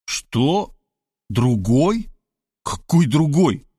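dumbfounded questions, slowing down; stunned. Acted. Robert learns Sveta has another man, stunned. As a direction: ask as if you cannot believe it.

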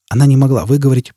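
ordinary delivery, nothing marked

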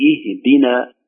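اهدنا slowly opened on i; kasra on hamza. Shown as a demonstration. Teacher applying the rule, اهدنا starting with i. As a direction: say ihdina for اهدنا, clear first i. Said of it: "تكسر الهمزة".

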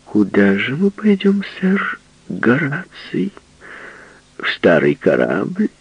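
high, slow mock politeness; mock-grand woman. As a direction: play the grand lady. Woman teasing "sir Horace.

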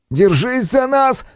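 high, slow shout; shouted warning. Acted. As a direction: yell the warning fast. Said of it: "успел выкрикнуть Синёв".

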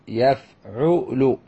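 يفعل very slowly as yaf-'u-lu; drawn-out teaching model of يفعل; syllable by syllable. Shown as a demonstration. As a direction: sound out يفعل, each syllable separate. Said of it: "هذا وزن فعل".

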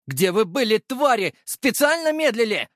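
high-pitched furious reproach; angry yelling. A narrator's reading. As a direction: rage at the guards for dawdling. Prisoner rages at slow guards. (яростный крик высоким голосом)

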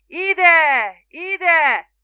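very loud, high drawn-out roar; bull-like bellow. A narrator's reading. Spiro hailing a passing boat from the bow, bellowing. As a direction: bellow like a bull across the water. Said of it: "bika bömbölése".

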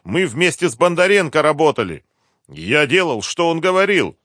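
high, loud, pleading excuses; cornered captive. A narrator's reading. Driver disclaims responsibility to interrogators, pleading. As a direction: plead that you only followed orders.

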